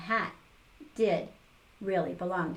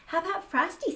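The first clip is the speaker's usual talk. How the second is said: put-on girl voice; 9 semitones higher, 4 dB louder, faster than usual. A narrator's reading. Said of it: "said Karen".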